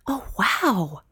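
soft breathy wow; a hushed gasp of wonder. Acted. Stella seeing the kangaroos, soft gasp. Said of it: "gasped Stella".

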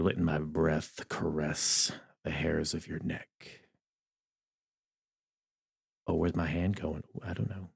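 low, soft, breathy seductive whisper; mock seduce the listener up close to the mic; sexy whisper in ear. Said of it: "I just got all sexy".

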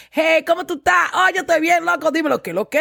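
high, lively Spanglish greeting; relaxed Spanglish accent. Shown as a demonstration. Guest greeting her friends, animated Spanglish accent. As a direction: let the Spanish accent come out with friends. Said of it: "I speak Spanglish".

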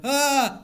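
high loud startled yell. Retold or imitated. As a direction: yelp in fright. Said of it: "I would be like".

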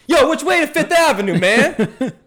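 high, loud street holler; yelling out. Retold or imitated. Blind man calls out for directions on the street, loud holler. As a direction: call out to the whole block. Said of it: "he yells out".

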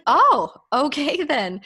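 high, loud, drawn-out mock surprise; taken-aback reply. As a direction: imitate someone thrown by the news. Retells his taken-aback reply.

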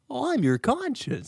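high, slow little cartoon voice; high-pitched slow impression; Jiminy Cricket. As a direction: lift it high and stretch it out. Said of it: "Jiminy Cricket".

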